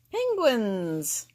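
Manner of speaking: plain reading voice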